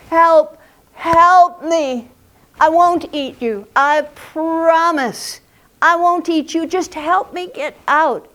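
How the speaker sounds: loud, high tiger voice, begging; pleading tiger. A narrator's reading. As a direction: call up urgently as a trapped tiger, promising safety. Trapped tiger begs the man.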